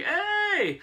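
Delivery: high, drawn-out mock greeting; Magneto. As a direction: sing out a very high, playful hello. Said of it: "and Magneto's like".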